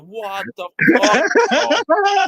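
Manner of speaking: slow, drawn-out, high and loud disbelief; re-enacted stunned outburst; retold shock. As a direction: stretch it out, acting appalled. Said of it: "I was like".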